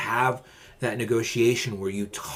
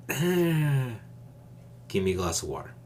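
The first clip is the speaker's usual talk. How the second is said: weary sighing order; 3 semitones higher than usual. Shown as a demonstration. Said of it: "Do you see the difference?".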